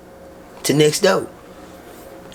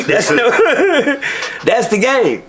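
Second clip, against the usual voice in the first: laughing; 7 semitones higher, much slower than usual. Real, not acted. Laughing at himself.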